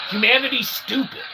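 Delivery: ordinary delivery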